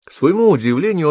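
usual speaking voice

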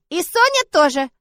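put-on high, loud, slow childish insistence; a toddler announcing her plan; confident little girl. Little Sonya insists on going with Liza, high childish voice. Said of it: "повторила девочка".

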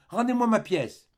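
put-on high, insistent child's voice; a boy pressing for his coin; pleading little boy. Child demands coin back.

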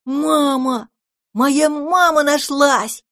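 high, slow, overjoyed cry; joyful shout. Acted. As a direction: call out as if you found your mother. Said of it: "радостно закричал".

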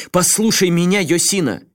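loud, furious call to a friend; angry plea. A narrator's reading. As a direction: demand he listen, heated. Асакава в бешенстве. (гневный возглас)

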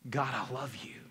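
low, soft words of love; subdued devotion. Acted. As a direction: say it quietly, pitched low. Same words on hard days.

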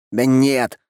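high, mild cry of denial; exclaimed denial. A narrator's reading. Man correcting his friends' wrong guess, mild shout. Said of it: "крикнул Фанеров".

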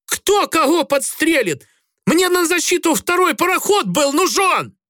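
put-on high, heated drunk sailor rant; shouting match. A narrator's reading. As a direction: yell back at the officer. Грицай shouts back at officer. (кричит в ответ)

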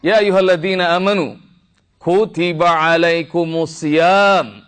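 high, ringing scripture declamation; impassioned preaching. Real, not acted. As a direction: proclaim it to the congregation, drawing out the verse. (seruan dakwah lantang bernada tinggi)

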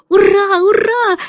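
high, loud joyful cheer; children cheering about going outside. A narrator's reading. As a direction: shout hooray with delight. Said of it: "ликовали они".

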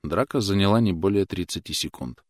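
ordinary delivery, nothing marked

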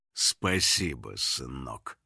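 low, slow, soft thanks; smug hunter father. A narrator's reading. Hunter thanking his son for the staff, smug. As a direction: sink the voice low and take your time. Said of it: "поблагодарил охотник мальчика".

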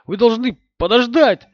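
high, loud pleading yell; desperate shout. Acted. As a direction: scream for them to wait. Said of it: "в отчаянии заорал он".

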